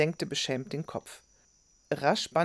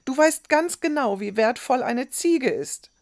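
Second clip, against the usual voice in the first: put-on high, loud, cross boy; sour retort; 9 semitones higher, 7 dB louder than usual. A narrator's reading. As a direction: argue back angrily in a raised voice. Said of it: "der nun ebenfalls sauer wurde". (sauer, laut und hoch)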